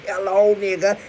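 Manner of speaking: loud high welcome; loud greeting. Acted. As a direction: call out the greeting.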